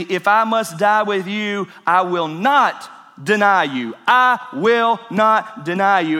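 slow, forceful declaration of loyalty; emphatic vow. Retold or imitated. Preacher quoting Peter's promise to Jesus, emphatic. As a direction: swear it firmly, landing on each word. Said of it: "But Peter said emphatically".

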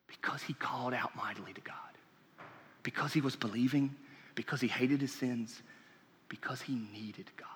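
hushed, breathy whisper for emphasis. Real, not acted. Preacher whispers the reasons to the congregation. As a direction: drop the voice to a whisper.

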